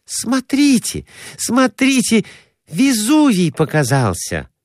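high-pitched woman's excited call; excited crying out. A narrator's reading. Woman showing travelling companions Vesuvius in the window, excited. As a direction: call out high, drawing attention to the sight. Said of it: "кричала Глафира Семёновна".

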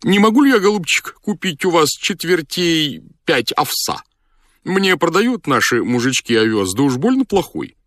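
high-pitched polite request; high character voice making an appeal; doctor's voice. A narrator's reading. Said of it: "обратился к нему доктор".

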